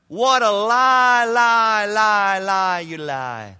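loud, slow "lie, lie, lie"; loud drawn-out denunciation. Real, not acted. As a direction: thunder it at the world. Preacher escalates denunciation.

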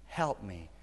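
low, soft, drained plea; hushed begging, imitated without tears; pleading. Wife on the bathroom floor pleading with God, quiet pleading. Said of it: "on the bathroom floor pleading with you".

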